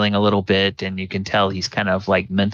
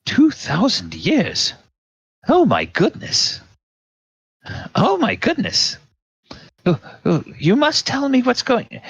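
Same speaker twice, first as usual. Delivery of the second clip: put-on flustered old mage, higher and higher; astonished old wizard; 14 semitones higher than usual. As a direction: gasp it out in wonder, pitch climbing. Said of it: "Adaluk says".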